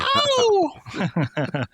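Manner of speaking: sharp, high yelping tennis grunt. Retold or imitated. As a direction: let out a shrill grunt like a tennis player mid-swing. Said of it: "tennis grunt".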